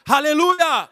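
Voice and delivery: high, loud hallelujah cry; loud joyful Aleluia; excited shout. Real, not acted. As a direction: burst out in praise. Preacher exclaims.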